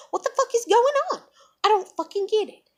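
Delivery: high, exasperated demand; going off. Retold or imitated. Venting to the manager about unfairness, high angry outburst. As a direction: burst out in disbelief. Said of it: "from where I did go off".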